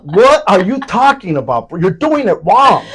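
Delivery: high, loud incredulous rant; outraged telling-off. Retold or imitated. Speaker scolding a suited man about Crocs, outraged shout. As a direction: shout in disbelief, hitting each phrase. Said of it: "I told him exactly like that".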